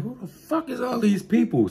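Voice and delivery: high, baffled squeak of a question; sudden confused alarm; high-pitched who-are-these-people. Retold or imitated. Confused rider sees strangers.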